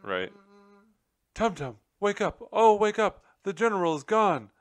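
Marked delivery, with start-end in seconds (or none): 1.3-4.5 high, loud panicked cry. A narrator's reading. Nutmeg bursting in to wake her husband, crying out high. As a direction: shake him awake in alarm. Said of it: "Nutmeg cried".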